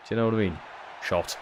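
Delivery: usual speaking voice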